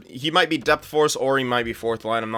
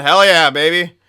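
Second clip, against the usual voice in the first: excited; 5 semitones higher, 10 dB louder than usual. Real, not acted. Cheering a goalie award.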